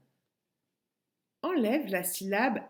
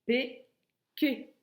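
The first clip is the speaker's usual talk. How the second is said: "P, quai" as two isolated syllables, pitched up; 5 semitones higher than usual. Shown as a demonstration. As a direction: leave a clear gap between "P" and "quai". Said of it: "j'enlève ro".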